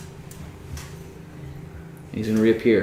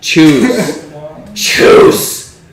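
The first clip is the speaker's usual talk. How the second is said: loud mocking spirit voice; 5 semitones higher, 9 dB louder than usual. Voices build to laughing command.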